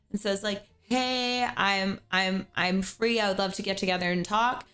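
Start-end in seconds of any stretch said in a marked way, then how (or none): none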